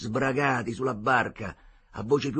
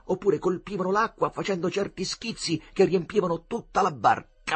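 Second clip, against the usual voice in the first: ordinary delivery, nothing marked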